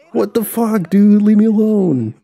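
high, loud, outraged yelp; upset victim voice. Acted. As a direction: squeal it like a startled stranger. Player voices upset NPC being harassed.

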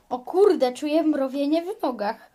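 loud, alarmed exclamation; alarmed outcry. Pretend. Mother alarmed by tingling legs, loud outcry.

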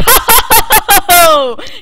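high, booming string of laughter; loud triumphant laugh. Real, not acted. Player nobody saw coming, loud gloating laugh. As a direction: laugh loudly in victory, falling off at the end.

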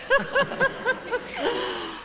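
high cackling laugh; drunken cackle. Acted. Drunk woman greeting old pals with a high cackle. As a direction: cackle it out, tipsy and delighted.

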